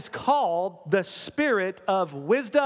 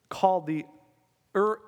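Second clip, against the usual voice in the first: normal talking voice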